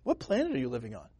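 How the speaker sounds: soft, fast disbelieving retort; incredulous Samuel. Retold or imitated. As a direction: mutter it in disbelief. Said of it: "I'd be like".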